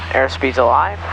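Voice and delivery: ordinary talk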